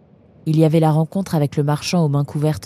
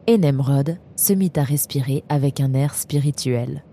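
plain reading voice

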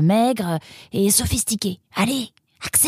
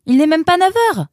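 raised, louder protest; indignant exclamation; 4 semitones higher, 5 dB louder than usual. Acted. Roxane protesting at an early visit, exclaiming. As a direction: exclaim it in disbelief. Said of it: "s'exclama Roxane".

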